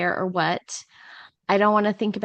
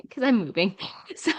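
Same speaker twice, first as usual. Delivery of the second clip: breathy, voiceless laugh-talk; 3 semitones higher than usual. Real, not acted. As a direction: let the words dissolve into a laugh. Laughs while admitting she is moving.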